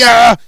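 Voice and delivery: loud, harsh bark; angry shout. Acted. Father snapping at his child with a loud shout.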